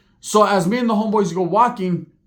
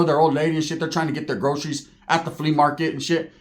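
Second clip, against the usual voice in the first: ordinary talk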